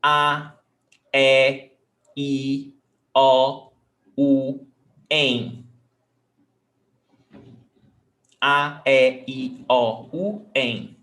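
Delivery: A, E, I, O, U, em with open vowels, one by one. Shown as a demonstration. Teacher showing acute accent sounds, open vowels. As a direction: recite the vowels openly and separately. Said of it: "open vowel sound".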